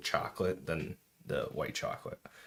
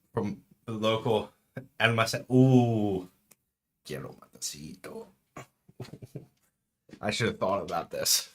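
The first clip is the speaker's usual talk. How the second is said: normal talking voice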